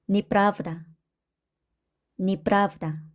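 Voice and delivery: 니프라브다 with first e said as i; unstressed e as i. Shown as a demonstration. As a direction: say 니프라브다, stress on the middle a. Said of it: "야는 이로 발음을 한다".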